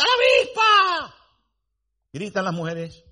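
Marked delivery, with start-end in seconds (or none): 0.0-1.1 high, slow alarmed shout; mother's shout. Retold or imitated. Mother in the car shouting at the wasp, high alarmed yell. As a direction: shriek it like a startled mother. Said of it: "La madre grita".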